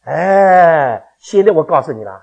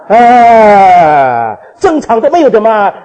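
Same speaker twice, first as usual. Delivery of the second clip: a sudden loud "ah" of disbelief; loud exclamation; 9 dB louder, much slower than usual. Real, not acted. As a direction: burst out in amazement. (惊讶地大声喊)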